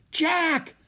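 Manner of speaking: very high, sharp scream of a name. A narrator's reading. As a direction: scream to get his attention. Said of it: "Annie screamed".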